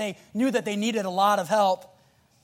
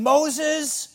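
higher-pitched mock whine; acted crowd grumbling, pitched up; whining complaint; 7 semitones higher than usual. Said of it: "whining and saying things and going".

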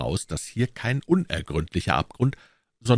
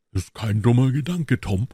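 higher, louder eager reply; lively; 4 semitones higher, 4 dB louder than usual. A narrator's reading. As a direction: jump at the idea eagerly. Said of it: "erwiderte Huck lebhaft".

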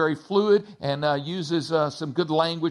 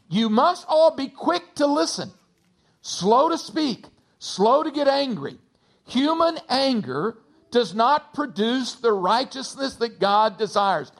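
higher, measured reading of scripture; 4 semitones higher than usual. Acted. Pastor reading James to the church, measured and solemn. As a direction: read the verses with weight.

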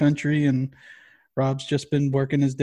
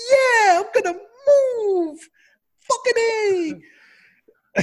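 squeaky, much higher mock enthusiasm; mock eager mover; 5 dB louder, much slower than usual. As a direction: parody someone thrilled to move, high and drawn out. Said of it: "is there anybody who's like".

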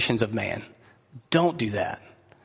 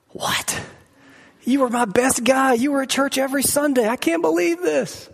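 much higher, scandalised disbelief; mock shocked God; 11 semitones higher than usual. God reacting to a believer's confession, hammed-up shock. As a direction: play God aghast at his best guy. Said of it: "he's not gonna be like".

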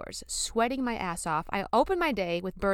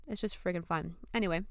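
ordinary talk, nothing marked

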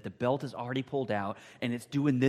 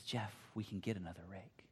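whispered and tender; gentle forgiveness; 5 semitones lower, 13 dB quieter than usual. Retold or imitated. Father whispering forgiveness to his crying son. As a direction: reassure him softly.

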